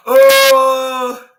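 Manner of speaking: loud, high crowd yell of "Oh!"; crowd cheer. Retold or imitated. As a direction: belt it like a cheering arena crowd. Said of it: "the fans start cheering, like".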